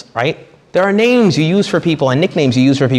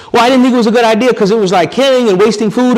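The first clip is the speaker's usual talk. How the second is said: higher, louder whiny Saul voice; whiny excuse; 10 semitones higher, 5 dB louder than usual. Retold or imitated. King Saul excusing himself to the prophet, whiny. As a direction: plead your excuse like a caught child.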